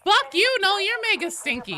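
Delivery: high, loud playful outburst; mock insult. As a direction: yell a teasing insult at a friend. Playful insult to a chat member.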